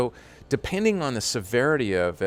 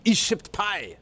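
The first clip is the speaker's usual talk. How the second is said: put-on the German-accented catch line pitched up high; mock German accent, higher; 9 semitones higher, slower than usual. Asked to say it again, high mock German accent. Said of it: "say it again".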